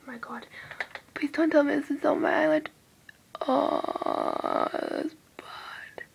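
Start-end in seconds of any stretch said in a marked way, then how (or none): 1.1-2.7 anxious pleading. Real, not acted. Afraid of a makeup smudge. 3.5-6.0 whisper. Real, not acted. Sees a makeup smudge.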